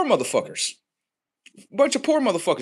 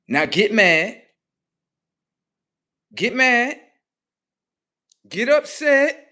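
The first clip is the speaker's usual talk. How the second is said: taunting; 9 semitones higher, 5 dB louder, slower than usual. Real, not acted. Taunting the viewers.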